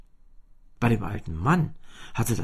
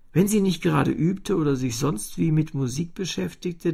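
ordinary delivery

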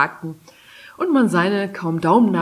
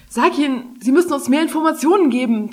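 urging; 7 semitones higher, 4 dB louder than usual. A narrator's reading. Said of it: "drängte Maeva".

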